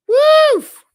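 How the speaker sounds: loud high woof of relief; dog-like woof to blow off steam; excited woof. Real, not acted. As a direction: let out a big happy woof. Excited bark after the rant.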